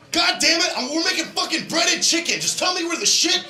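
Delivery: high, furious ranting shopper; shouting about breaded chicken; angry yelling. Retold or imitated. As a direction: yell it like a man losing it in the aisle.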